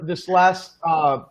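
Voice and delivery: normal talking voice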